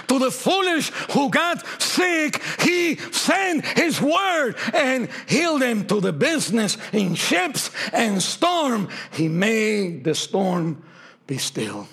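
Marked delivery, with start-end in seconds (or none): 0.1-5.9 preaching build. Real, not acted. Climactic preaching.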